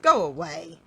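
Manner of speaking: loud, rude dismissal at normal pitch. A narrator's reading. Mister Uppity dismissing the goblin, rudely. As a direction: send them off rudely and loudly.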